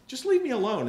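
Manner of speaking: impatient brush-off; curt. Retold or imitated. Student to man wanting his table, curt and impatient. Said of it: "I kind of got a little short with him".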